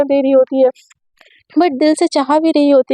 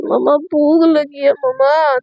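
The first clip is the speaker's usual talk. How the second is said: put-on whiny sick girl; slower than usual. A narrator's reading. Sick girl calling mother.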